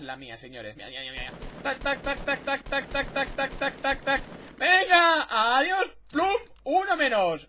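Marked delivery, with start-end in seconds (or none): 1.6-4.2 rhythmic "tac tac" shot noises, higher; imitated gunfire. Streamer narrating his shots as rhythmic gun noises. 4.6-7.5 loud, high victory shouts; triumphant yells. Real, not acted. Streamer celebrating knocking enemies off, loud triumphant yelling.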